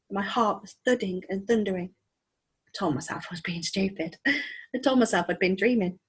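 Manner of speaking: ordinary delivery, nothing marked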